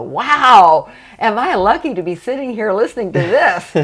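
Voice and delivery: delighted amazement breaking into laughter; amazed wow. Retold or imitated. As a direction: marvel out loud at your own luck. Retelling own amazed reaction.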